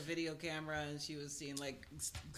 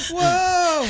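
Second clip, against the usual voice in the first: surprised gasp; 5 semitones higher, 7 dB louder than usual. Pretend. Mock gasp on introduction.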